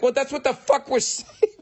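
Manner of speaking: very high exasperated cry breaking into laughter; laughing exasperation. Retold or imitated. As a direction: crack up at the end of the protest.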